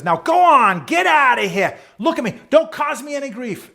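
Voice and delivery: high, full shout of a dad sending someone off; imitation of a loud, gruff-worded send-off; hollering dad. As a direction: bellow it like a bossy father. Dad yells son off the bus.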